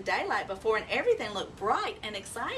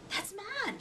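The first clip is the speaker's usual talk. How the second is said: excited; 9 semitones higher, 6 dB quieter than usual. Acted. Owlet hears her hoot.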